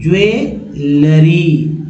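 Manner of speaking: ज्वेलरी drawn out slowly as jue-luh-ree; slow clear pronunciation. Shown as a demonstration. Teacher to class, slow model of ज्वेलरी. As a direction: spell out ज्वेलरी for students, slowly. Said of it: "लेकिन यहाँ पर ज्वे".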